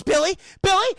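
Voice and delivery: high, loud acted call; imitated worried mother calling out; alarmed calling. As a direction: call into a dead phone line. Acted mother after line cut.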